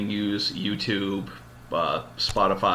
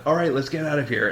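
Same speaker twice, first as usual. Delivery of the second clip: higher, pushy "let's get out of here"; urgent getaway partner; 7 semitones higher than usual. Retold or imitated. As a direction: hurry your partner out, voice raised. Pedro Pascal urging to leave.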